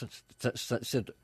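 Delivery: tripping over repeated s sounds; stuttering. Real, not acted. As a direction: stumble on the first sound before getting the word out. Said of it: "[name]'s starting to stutter".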